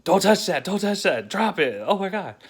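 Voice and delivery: high, loud panicked yelling; freaked out. Retold or imitated. Dad panicking at daughter holding a dead chipmunk, loud high yell. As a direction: yell at her to drop it in a panic. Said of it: "freaked out".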